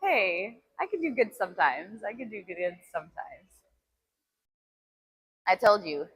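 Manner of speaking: usual speaking voice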